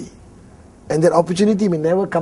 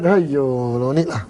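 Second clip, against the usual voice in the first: dismissive auntie; 8 semitones lower, 5 dB quieter, slower than usual. Retold or imitated.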